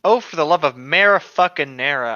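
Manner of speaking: ordinary delivery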